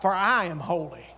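"I" stands out, the rest falls away low; low, measured phrase leaning on "I"; stress on I. Shown as a demonstration. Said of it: "It should be in the latter part".

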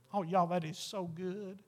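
ordinary talk

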